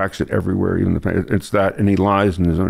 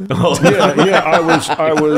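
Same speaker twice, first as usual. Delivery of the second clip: laughing through the words; words bubbling with laughter; 7 semitones higher, 4 dB louder, much slower than usual. Real, not acted. Laughing at the retold moment.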